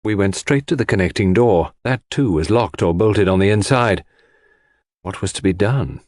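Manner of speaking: ordinary delivery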